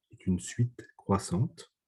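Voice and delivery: slow, deliberate dictation, every word spaced out. Shown as a demonstration. Teacher slowing down so students can copy, slow and deliberate. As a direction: take it down a gear so they can write it. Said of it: "je parle plus lentement".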